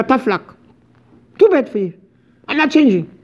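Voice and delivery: high, lightly mocking tough luck; defiant dismissal. Speaker mimics the stubborn reply, high mock defiance. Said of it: "Like I said in America".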